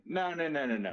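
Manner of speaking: normal talking voice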